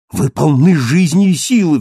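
loud, high, passionate protest; exclaiming. A narrator's reading. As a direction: cry out in urgent encouragement. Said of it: "вскричал Мариус".

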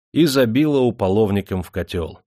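plain reading voice

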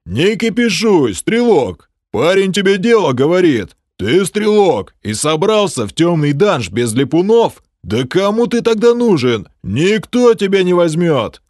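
scolding clansman. Acted. Clan member scolding archer.

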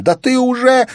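very high, loud accusing cry; tearful shout. A narrator's reading. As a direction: cry out at your husband until cut off. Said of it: "закричала Улла со всхлипыванием".

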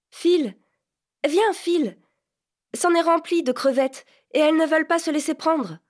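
put-on urgent girl calling her friend over; excited, impatient girl's shout; young Vanka calling out.

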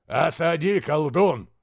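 loud, stern command. Acted. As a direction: bark the order. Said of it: "Громкий голос Асмуда".

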